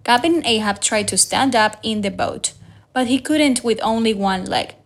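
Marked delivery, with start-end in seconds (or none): none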